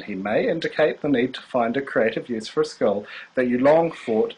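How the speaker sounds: soft, even reading voice like a fortune teller; mild fortune teller read; tarot reading. Acted. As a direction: read the card meaning calmly, gently mystical. Reads a tarot card meaning.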